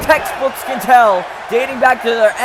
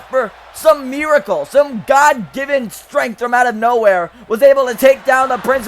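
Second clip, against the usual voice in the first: usual speaking voice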